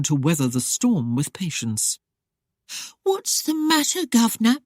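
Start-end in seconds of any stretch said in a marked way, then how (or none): 3.0-4.6 high mock-wounded Cockney pout; hammed-up hurt, much higher pitch; feigned hurt. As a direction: pretend to be hurt to bait him. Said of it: "in a feigned tone of hurt".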